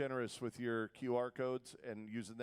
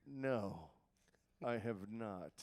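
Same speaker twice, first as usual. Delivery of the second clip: low, deliberate dad reply; understated, lowered fatherly voice; dad's voice; 5 semitones lower, 5 dB quieter, much slower than usual. Retold or imitated. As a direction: answer slowly and softly as Dad. Dad answering in the story.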